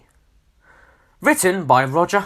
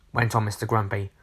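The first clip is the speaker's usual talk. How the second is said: plain reading voice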